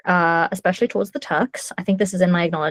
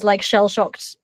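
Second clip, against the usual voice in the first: ordinary talk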